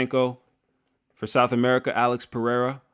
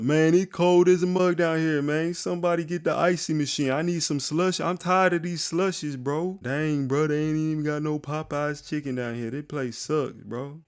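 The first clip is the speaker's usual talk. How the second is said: higher put-on voice complaining about the cold; joking fighter voice; 5 semitones higher than usual. Vlogger imitating a fighter griping about Antarctica, higher voice. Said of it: "He's gonna be cracking jokes".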